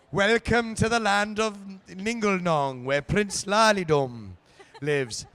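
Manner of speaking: slow, lilting fake Welsh accent; sing-song bad Welsh accent, drawn out; soft, slow put-on Welsh lilt. Shown as a demonstration. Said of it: "soft, strange, non-Welshy, bad Welsh".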